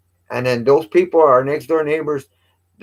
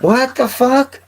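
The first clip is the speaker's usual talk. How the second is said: stunned, drawn-out what the fuck, pitched way up; shocked; 12 semitones higher, 4 dB louder than usual. Real, not acted. Hearing a stranger sat in his truck, real shock. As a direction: caught off guard by news about his truck.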